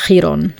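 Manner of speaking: Chiron said the German way, HEE-ron; German Chiron, with no K sound; German pronunciation. Shown as a demonstration. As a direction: open Chiron with a soft German ch and a long ee. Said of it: "in German, we would say".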